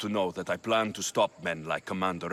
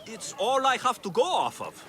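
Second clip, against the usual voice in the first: higher, lightly put-on voice of a loyal follower; pretend Cleon supporter; 11 semitones higher, 3 dB louder than usual. Posing as Cleon's supporter to help Socrates, higher and lightly put-on. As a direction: play the naive crowd member defending Cleon. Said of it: "respond as if you're one of Cleon's supporters".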